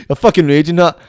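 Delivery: usual speaking voice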